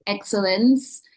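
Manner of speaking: ordinary talk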